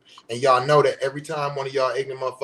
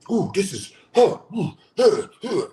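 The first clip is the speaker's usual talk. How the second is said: mock reaction; 4 semitones higher, 6 dB louder than usual. Mocking food reaction video.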